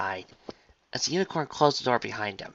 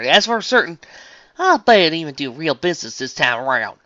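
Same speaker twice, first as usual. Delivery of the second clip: loud, higher country twang; impressed southern farm pony; 5 semitones higher, 12 dB louder than usual. Acted. Farmer hears of the agriculture exhibition, impressed twang.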